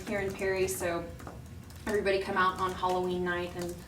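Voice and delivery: ordinary talk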